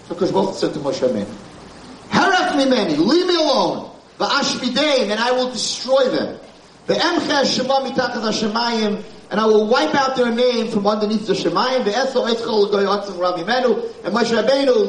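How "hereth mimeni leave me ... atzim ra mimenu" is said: raised, commanding divine decree; stern voice of God. Acted. Rabbi voicing Hashem's anger at the nation, stern high command. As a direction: thunder it down as God rebuking Moshe.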